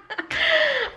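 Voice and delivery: a squeaky delighted laugh; quick high-pitched giggle. Real, not acted. Touched by boyfriend's gesture.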